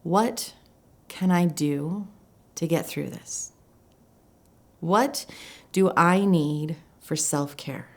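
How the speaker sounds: slow, deliberate questions with long pauses; measured, searching self-talk; asking oneself. Retold or imitated. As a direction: feel out each question slowly, pausing mid-phrase. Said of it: "I started to ask myself".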